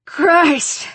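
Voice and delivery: high exclamation; shaken oath. Acted. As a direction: blurt out an oath after the struggle. Sharon panting after struggle.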